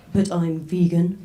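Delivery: imitating a slow, pointed "But I'm vegan"; pointed reply. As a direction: drag it out like a pointed correction. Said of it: "I can do the exact tone they responded to it in".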